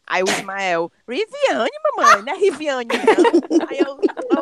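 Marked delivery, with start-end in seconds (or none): 1.1-3.7 put-on child correcting mom. Son corrects mother.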